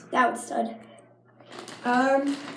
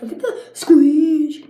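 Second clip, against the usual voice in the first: slow, louder insistent order; mock command; 3 semitones higher, 9 dB louder, slower than usual. Ordering viewers to watch the squishy, mock bossy. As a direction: drag it out bossily. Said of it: "I'm like".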